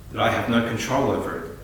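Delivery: ordinary talk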